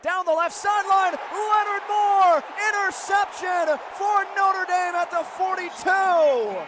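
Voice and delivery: high, loud interception call; excited play-by-play. Real, not acted. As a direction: draw out the big words in a ringing, excited shout. Interception called live.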